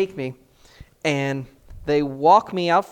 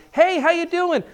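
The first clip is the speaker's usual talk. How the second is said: cheery passerby greeting; 12 semitones higher, 6 dB louder than usual. Retold or imitated. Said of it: "someone would walk by".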